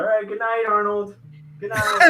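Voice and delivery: cooing to a pet bird. Real, not acted. Talking to his bird.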